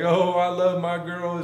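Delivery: high-pitched mock Southern man; Southern accent. Storyteller as the punched man praising his girl, Southern accent. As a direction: imitate a Southerner gushing over his girlfriend. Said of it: "they both had Southern accents".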